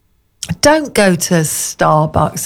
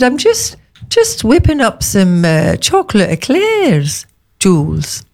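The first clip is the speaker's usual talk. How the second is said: put-on much higher, Scottish chef accent; mock Scottish accent, pitched up; 3 semitones higher, slower than usual. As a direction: imitate the Scottish chef offering eclairs. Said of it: "You sound like Janet from Doctor Finlay's casebook".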